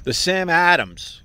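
Sam drawn out in a Midwestern accent; playful Midwestern accent on the brand name. Shown as a demonstration. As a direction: lean into a Midwest twang, slowly. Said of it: "what accent is that, Ope?".

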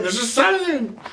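insisting. Retold or imitated. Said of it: "I kept saying".

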